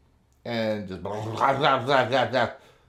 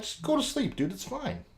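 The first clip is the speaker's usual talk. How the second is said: placating; 12 semitones higher, 5 dB quieter than usual. Retold or imitated. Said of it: "And I'm like".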